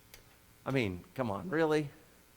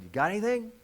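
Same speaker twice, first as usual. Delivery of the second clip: mocking heckler; 9 semitones higher than usual. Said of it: "cries out and said".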